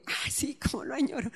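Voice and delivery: sighed, breathy longing; wistful longing. Retold or imitated. Speaker recalling her thought on hearing the hymn, wistful longing. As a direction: sigh it softly, as if pining.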